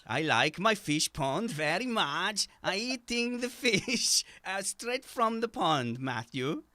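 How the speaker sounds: put-on slow, high-pitched singsong Swedish accent; mock Swedish accent. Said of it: "You're both from Sweden".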